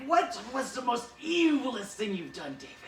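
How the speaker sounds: high, loud villain voice; Cobra Commander. Retold or imitated. Recruiter grilling David in a high, loud villain voice. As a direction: play the evil boss recruiting. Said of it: "which might have been how Cobra Commander recruited you, like".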